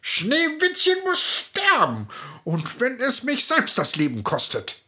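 shaking with fury; furious scream. Acted. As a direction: shriek with murderous rage. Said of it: "bebte vor Zorn und schrie".

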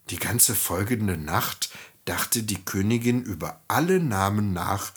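plain reading voice

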